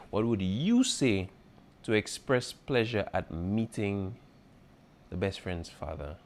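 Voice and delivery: slow, deliberate rereading. Shown as a demonstration. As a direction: read the question slowly for the class. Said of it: "teacher rereads question slowly".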